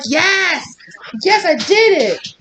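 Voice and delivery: very high, triumphant cheer as the dog; super hyped. Retold or imitated. Dog celebrating that she opened the door, super hyped. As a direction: shout it high, stretching the victory. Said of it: "She's super hyped".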